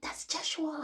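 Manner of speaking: high, strained cry of recognition; retold scream. Retelling a dream, crying out to hospital staff, high strained shout. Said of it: "I started screaming to the hospital staff".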